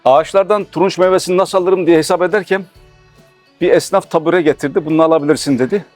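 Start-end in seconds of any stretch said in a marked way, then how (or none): none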